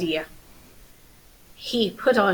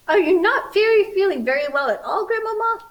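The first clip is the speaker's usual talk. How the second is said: high, fast and anxious; worried little girl; 10 semitones higher, 4 dB louder than usual. Acted. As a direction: ask it like a fretting child. Red worried about grandmother.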